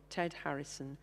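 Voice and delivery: ordinary talk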